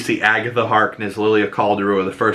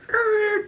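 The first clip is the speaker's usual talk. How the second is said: high squeal of a laugh; 3 dB quieter than usual. Real, not acted. Laughing at own impression.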